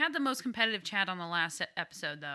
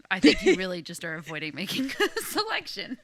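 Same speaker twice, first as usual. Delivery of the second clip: laughing; 7 semitones higher, 5 dB louder than usual. Real, not acted. Teasing co-host, laughing.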